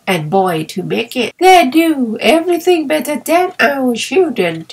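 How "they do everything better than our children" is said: smug, self-satisfied mother, a little higher; lightly voiced proud mother; mother boasting of her children; complacent. A narrator's reading. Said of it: "said she very complacently".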